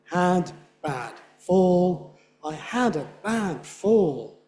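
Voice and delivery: slow, "Had bad fall" each hit; emphatic beats. Shown as a demonstration. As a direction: pound every stress. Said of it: "it's quite emphatic".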